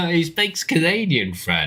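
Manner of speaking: amused, voice lifted by real laughter; laughing. Real, not acted. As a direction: say it through laughter. Laughing in banter over accents.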